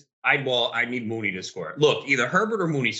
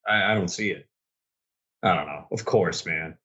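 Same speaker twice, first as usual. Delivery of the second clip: ordinary talk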